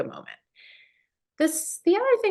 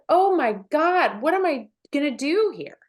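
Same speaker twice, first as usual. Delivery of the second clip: exasperated; 6 semitones higher, 4 dB louder than usual. Retold or imitated. Parent facing a screaming kid again, mock exasperated. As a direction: throw up your hands in mock panic. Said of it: "And you're just like".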